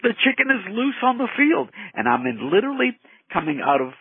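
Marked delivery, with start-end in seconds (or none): 0.0-1.7 high, excited mock crowd cry; screaming fans, imitated. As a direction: imitate alarmed fans calling out, pitched up. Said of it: "the fans start screaming".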